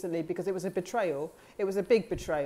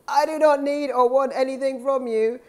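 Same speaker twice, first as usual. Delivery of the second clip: raised, loud re-enacted refusal; forceful declaration; 6 semitones higher, 8 dB louder than usual. Speaker re-enacts putting a hand out and refusing, loud and emphatic.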